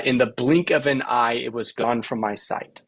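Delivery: "in the BLINK of an EYE" in da-da-DUM lilt; three-beat rhythm. Shown as a demonstration. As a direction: land on BLINK, EYE, GONE, SIGHT. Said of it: "you can shape any sentence this way".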